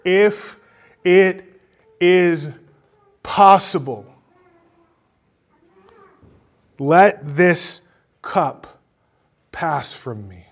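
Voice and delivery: loud, high plea, each phrase set apart; crying out in prayer. Acted. Jesus praying to his Father in the garden, crying out. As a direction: cry out haltingly, easing off at the end. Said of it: "You hear him crying out in prayer".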